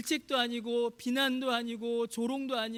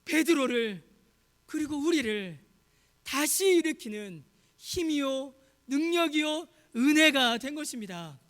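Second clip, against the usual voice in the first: loud, raised sermon delivery; high, emphatic pulpit proclamation; impassioned preaching; 5 semitones higher, 6 dB louder than usual. Real, not acted. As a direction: proclaim it forcefully to the congregation. Preaches at full force.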